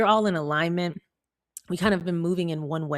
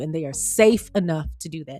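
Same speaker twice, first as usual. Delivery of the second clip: ordinary talk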